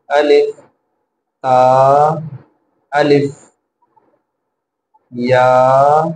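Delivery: short "الف", long held "لام", "با", "نون"; slow Arabic spelling drill, "لام" and "نون" drawn very long with pauses between; letters read separately. Shown as a demonstration. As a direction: recite each letter alone, stretching all but "الف" on a steady tone. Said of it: "الگ الگ پڑھنا ہے".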